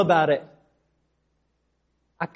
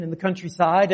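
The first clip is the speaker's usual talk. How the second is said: usual speaking voice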